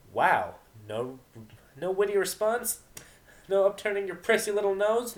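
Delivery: high, taunting jeer; mock her silence; sneering tease. Vinyl mocking.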